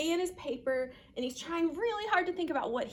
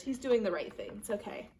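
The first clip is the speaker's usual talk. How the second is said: usual speaking voice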